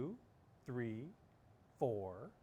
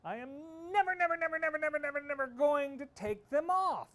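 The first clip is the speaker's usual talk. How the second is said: stubborn shouting; 12 semitones higher, 6 dB louder than usual. Acted. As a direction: hammer every never defiantly. Tina vows repeatedly.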